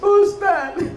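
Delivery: high, loud tearful call; a hammed-up sob in the voice; mock crying mother. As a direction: play the weeping mother lightly. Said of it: "Si ibu nangis".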